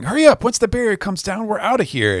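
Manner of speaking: high-pitched hurried shout; urgent call. Acted. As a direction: call the crew to flee. Acted crew member calling to hurry.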